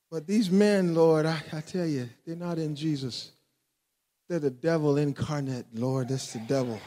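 low, soft complaint to God; restrained, low-voiced retold prayer; confiding prayer. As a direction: confide quietly in the Lord. Retelling his prayer about these men.

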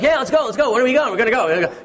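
high, laughing let's-go chant; eager kid. Retold or imitated. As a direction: bounce like a kid who can't wait. Son eager to leave.

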